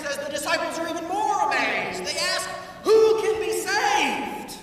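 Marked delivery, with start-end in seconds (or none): 2.8-4.6 loud, slow desperate question; alarmed cry. Acted. Disciples crying out to Jesus in alarm.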